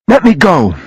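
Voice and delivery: high desperate plea. A narrator's reading. Sophie to the man gripping her wrist, desperate. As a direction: beg urgently to be released. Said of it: "she said desperately".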